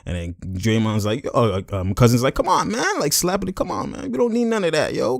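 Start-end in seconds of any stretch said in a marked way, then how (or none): 2.3-3.0 high-pitched imitated plea; Cousins pleading. Cousins slapping him to calm him, pleading high. 3.6-5.2 high, light imitated coaxing; Cousins pleading. Cousins calming a teammate, pleading.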